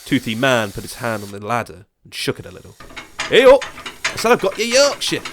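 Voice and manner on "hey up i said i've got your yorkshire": put-on much higher, loud old man's yell; insistent shout. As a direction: bellow it up the ladder, won't be ignored. Old man shaking the ladder.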